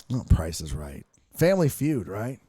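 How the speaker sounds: usual speaking voice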